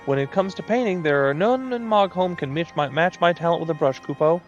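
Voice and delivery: high, showy moogle painter; moogle artist voice. Acted.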